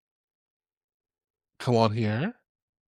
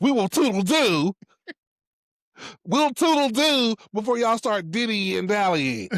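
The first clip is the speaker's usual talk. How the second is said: a hint of a Real Housewives diva; imitating Stacy; 7 semitones higher than usual. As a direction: ham up the Housewives goodbye. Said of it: "That was Stace-, Stacy".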